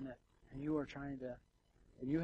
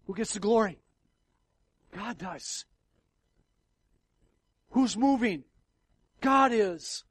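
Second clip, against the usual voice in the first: higher, much louder fervent preaching; preaching at full force; 9 semitones higher, 16 dB louder than usual. Acted. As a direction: thunder it from the pulpit.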